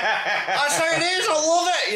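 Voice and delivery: mock giddy woman; high-pitched laughing impression; squealing lassie. Lassie voice retold.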